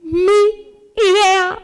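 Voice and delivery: slow, drawn-out wounded protest; hammed-up outrage; indignant disbelief. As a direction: stretch it out, aghast at being robbed of credit. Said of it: "Y vos decís".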